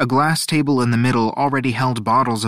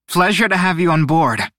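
higher, crisp captain's welcome; sharp and clear; 5 semitones higher, 4 dB louder than usual. A narrator's reading. Marea welcomes them on board, sharp and clear. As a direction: greet the crew sharply and clearly. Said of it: "her voice sharp and clear".

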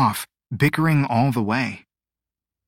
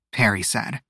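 plain reading voice

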